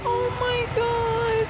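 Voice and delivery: very high, slow drawn-out gasp; overwhelmed aww. Real, not acted. Host watching the trucker comfort his dog, drawn out high. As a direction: melt at the clip, drawn out high.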